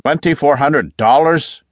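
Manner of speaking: loud, incredulous outburst; yell. A narrator's reading. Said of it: "yelled Flint".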